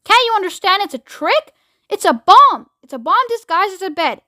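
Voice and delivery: very high, exasperated outburst; angry yell. A narrator's reading. President making Showler understand, angry yell. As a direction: yell it as an urgent warning. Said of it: "yelled the President".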